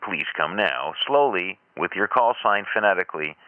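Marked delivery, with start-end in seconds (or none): none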